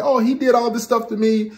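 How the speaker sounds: high, self-pitying complaint; mock complaining wife. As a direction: whine it up high.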